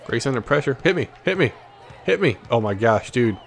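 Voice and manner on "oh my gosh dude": normal talking voice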